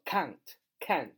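can't with the open æ of "cat"; stretched-out can't, æ as in "cat"; open æ vowel. Shown as a demonstration. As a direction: say can't slowly, drawing out the æ vowel. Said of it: "发那个蝴蝶音".

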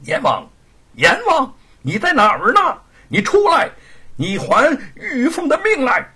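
high, loud angry demand; furious shout. Acted. Monkey King storming the underworld, shouting furiously. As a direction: roar for the king to come out. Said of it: "大声地叫道".

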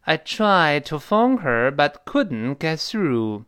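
slow, drawn-out model sentence; slow example. Shown as a demonstration. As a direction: read it slowly for learners. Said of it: "你比方说".

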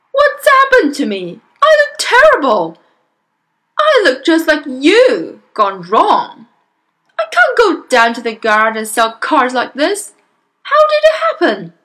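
loud, high, panicked outburst; yelling father. Acted. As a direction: wail loudly at your own reflection. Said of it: "yelled the father".

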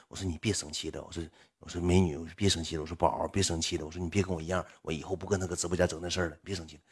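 soft, low repeated pleading; coaxing. Retold or imitated. Streamer promising the donor to behave, quietly retold coaxing. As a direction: placate in a subdued retold murmur. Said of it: "一顿哄".

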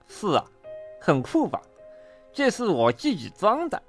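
lisping, pleased boast; self-satisfied animal doctor; proud beaver dentist. A narrator's reading. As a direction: show off with a toothy lisp. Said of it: "自豪地说".

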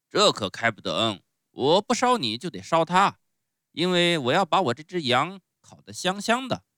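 loud, theatrical ogre voice; stern showman, refusing. Acted. Showman refuses to pardon the clown, loud bluster. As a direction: boom out the refusal.

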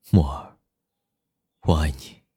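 low, soft and loving; tender confession. Acted. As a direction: confess love gently, close to the ear. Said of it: "缱绻深情地说道".

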